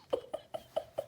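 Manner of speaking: soft, breathy chuckle; real, nearly silent giggle; quiet laugh. Real, not acted. Amused by Jokey Smurf card.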